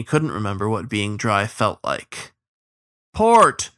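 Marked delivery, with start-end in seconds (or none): 3.1-3.7 one high, loud yell; yelled command. A narrator's reading. Captain to his crew in heavy rain, yelled. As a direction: yell the order to the rowers. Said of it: "yelled the captain".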